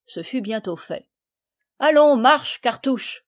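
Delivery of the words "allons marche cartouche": ordre crié, fort; gruff command. A narrator's reading. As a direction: pousse le voleur en avant. Count orders thief. (le comte ordonne au voleur, fort)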